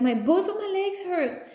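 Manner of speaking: put-on little boy telling mom it hurts; a hint of a hurting child; complaining child. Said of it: "he's like".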